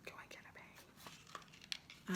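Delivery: soft unvoiced whisper; whispered aside. Real, not acted. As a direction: whisper it as a quick aside to the class. Aside while waiting for pens.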